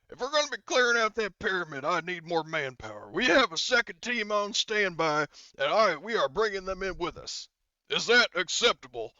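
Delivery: emphatic in-character bargaining; acted soldier negotiating. GM voices Gunny stating his condition.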